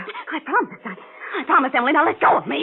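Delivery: high breathless promising; terrified pleading. Acted. Terrified promise under force.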